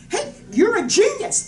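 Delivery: high, loud cheerleading; encouraging mother. Retold or imitated. Mom tells her boy he's special, excited. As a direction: pitch it up, gushing with praise.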